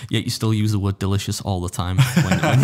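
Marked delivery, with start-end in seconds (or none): 2.0-2.6 louder, higher, laughing through the words; cracking up mid-sentence. Real, not acted. Laughing at the teasing about delicious.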